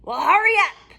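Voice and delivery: high, loud impatient witch. Acted. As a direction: hurry her like a cranky witch. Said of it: "said Bony Legs".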